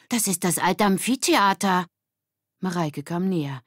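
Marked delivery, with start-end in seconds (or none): 0.1-1.8 put-on higher, louder boy voice; proud boy. Erik proudly presenting the old amphitheater. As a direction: show off the find.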